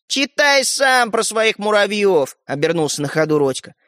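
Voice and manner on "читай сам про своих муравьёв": slow, higher parting jibe; jeering. Acted. Brother walking off from Стёпа, jeering. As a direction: call it back mockingly, stretched out.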